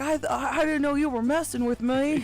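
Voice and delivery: put-on Southern accent. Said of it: "I don't know why he's Southern accent".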